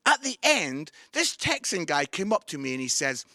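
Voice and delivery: ordinary talk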